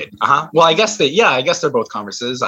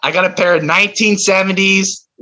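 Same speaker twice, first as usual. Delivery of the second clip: high, forceful, punched-out announcement; exasperated and emphatic; angry; 10 semitones higher, 4 dB louder, slower than usual. Acted. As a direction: force it out word by word over interruptions. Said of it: "I'm getting really angry".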